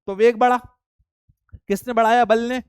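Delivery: teacher calling out the answer with force; loud drill. Real, not acted. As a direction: hammer the point home to the class.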